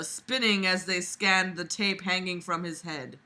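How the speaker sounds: normal talking voice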